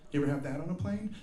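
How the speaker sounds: ordinary talk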